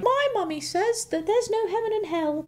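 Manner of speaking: put-on very high, drawn-out little kid voice; high-pitched mimicked child; child on playground. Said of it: "my child going around on the playground".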